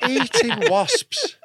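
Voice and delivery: high, slow, laughing disbelief. Real, not acted. Incredulous laughing at wasp eating.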